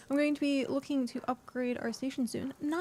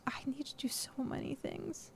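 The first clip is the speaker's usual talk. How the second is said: whispering; 3 semitones higher, 8 dB quieter than usual. Real, not acted. Whispered aside to herself.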